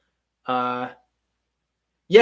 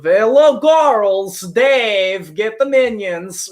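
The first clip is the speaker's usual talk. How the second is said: hammed-up Russian accent, loud and high; exaggerated cartoon Russian villain calling out; Gru's Russian accent; 5 semitones higher, 6 dB louder, much slower than usual. Actor showing chat their accidental Russian voice, hammed-up cartoon accent. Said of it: "naturally go into this Gru".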